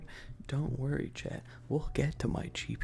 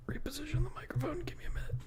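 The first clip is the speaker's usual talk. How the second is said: whisper; much faster than usual. Acted. Streamer whispering to chat while fixing the mic. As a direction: quickly whisper the aside.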